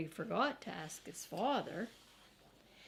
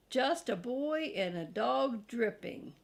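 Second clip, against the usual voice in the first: usual speaking voice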